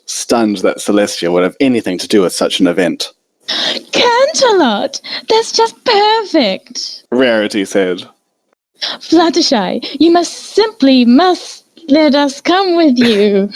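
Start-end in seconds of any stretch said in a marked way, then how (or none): 3.5-7.0 very high, slow delighted cry; thrilled Rarity. A narrator's reading. Rarity thrilled by Canterlot, very high and slow. 8.8-13.5 high, slow-started eager plea; eager Rarity. A narrator's reading. Rarity begging Fluttershy to take them along, high and eager.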